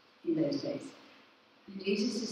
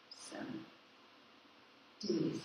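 whispered ending; 6 semitones lower, 14 dB quieter than usual. Pretend. Voice of God fading.

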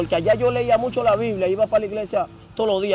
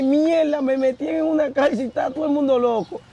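high-pitched retold shock; acted dismay; 7 semitones higher than usual. New prisoner retelling his shock at jail, acted dismay. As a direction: exclaim it as if just realising, pitched up.

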